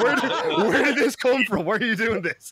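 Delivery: high, loud, laughing disbelief; incredulous laughing. Retold or imitated. Viewer baffled by the tester's sudden accent, laughing high. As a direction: ask it half laughing in disbelief.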